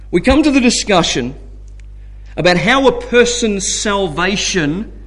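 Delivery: ordinary talk, nothing marked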